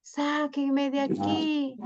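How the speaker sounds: mock tearful cry for help; crying plea. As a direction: beg to be rescued, slowly and tearfully. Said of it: "se puso a llorar y a llorar".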